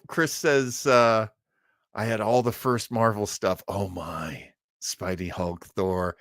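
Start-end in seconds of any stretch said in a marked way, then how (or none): none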